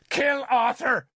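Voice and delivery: high, frantic shouted order. A narrator's reading. Nimue orders her sworn man to kill, shouting. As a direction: shout it as a shrill command. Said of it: "she shouted at me".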